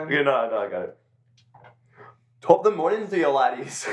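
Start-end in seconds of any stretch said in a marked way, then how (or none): 2.4-3.9 put-on loud, raised-pitch Irish accent. Contestant taking his try, loud Irish accent. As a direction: put on a hearty stage-Irish greeting. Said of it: "do an Irish accent".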